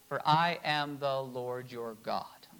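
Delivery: low, slow and set apart; a grave divine declaration; solemn voice of God. Acted. Reading God's words in Leviticus.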